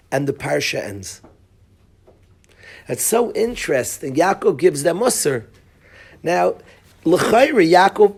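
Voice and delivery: normal talking voice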